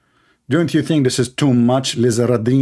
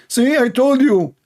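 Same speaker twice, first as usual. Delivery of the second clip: mock gloating; 11 semitones higher than usual. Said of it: "mock dialogue".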